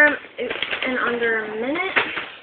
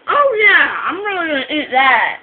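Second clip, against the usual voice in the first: sarcastic; 4 semitones higher, 9 dB louder than usual. Real, not acted. Mocking protest while eating crackers.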